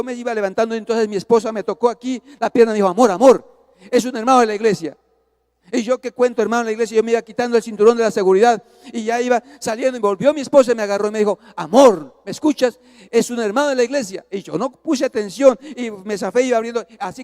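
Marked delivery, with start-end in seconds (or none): 2.9-5.0 loud, insistent wife stopping husband; urgent warning. Retold or imitated. Esposa intenta frenar al marido airado. 11.6-14.3 retold wife demanding to be heard; loud, pressing call for attention; insistent appeal. Esposa insiste en ser escuchada.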